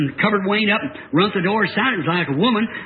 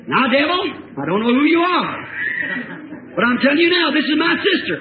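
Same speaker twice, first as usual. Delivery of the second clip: raised, high commanding voice; confronting the devil; 8 semitones higher than usual. Retold or imitated. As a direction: challenge the devil head-on. Retells himself confronting the devil.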